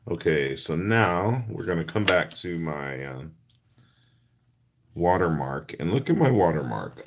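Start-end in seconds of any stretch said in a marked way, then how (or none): none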